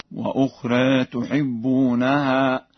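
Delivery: slow recitation, «وأخرى» ending nearly "-ree"; Warsh taqlil. Shown as a demonstration. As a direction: tilt the final vowel of «وأخرى» toward e. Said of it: "بتقليل الألف". (تلاوة ورش بتقليل ألف «وأخرى»)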